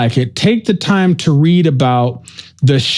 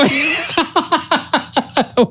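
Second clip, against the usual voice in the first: soft laugh trailing after the joke; high laugh; 11 semitones higher, 5 dB quieter than usual. Real, not acted. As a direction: laugh quietly at yourself. Laugh after own joke answer.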